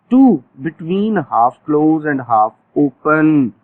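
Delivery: very slow, each word spaced out; stretched-out classroom dictation; slow drill phrase. Shown as a demonstration. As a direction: dictate it slowly for the class to repeat. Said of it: "teacher drills the phrase".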